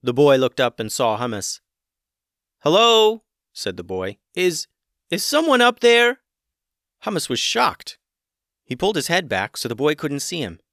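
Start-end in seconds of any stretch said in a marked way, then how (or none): 2.6-3.2 put-on high young boy calling up; high boy's voice calling up a well. A narrator's reading. 4.3-6.2 put-on high young boy calling up; high boy's voice calling up a well. A narrator's reading.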